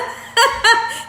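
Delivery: high burst of giggles; helpless high laughing; real laughter. Real, not acted. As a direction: crack up at the joke. Laughs at husband's joke.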